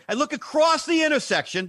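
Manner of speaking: usual speaking voice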